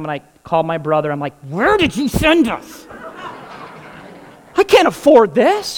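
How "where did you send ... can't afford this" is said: high, alarmed whisper-to-shout on the phone; stressed caller hiding in the bathroom; panicked. Retold or imitated. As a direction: voice jumps up in disbelief. Panicked phone call to brother.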